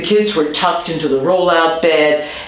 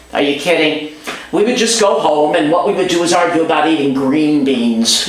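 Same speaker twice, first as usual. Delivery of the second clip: ordinary delivery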